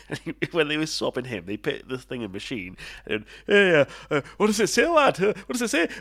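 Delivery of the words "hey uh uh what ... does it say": put-on loud, high Scottish accent, jovial; jolly Scotsman. Scotsman teasing the airport security guard, loud jolly Scottish accent. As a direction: boom it out like a cheery Scot at the airport. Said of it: "great big fat jolly Scotsman".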